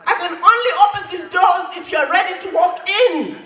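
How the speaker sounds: rising, ringing preacher's call; rousing oratory. Acted. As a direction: lift the hall with each "you". Rousing climax of his speech.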